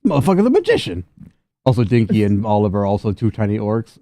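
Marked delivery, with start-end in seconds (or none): 0.1-1.0 very high, amazed outburst; stunned gang member marvels at the trick; amazed gangster. Acted. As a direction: gasp it in disbelief.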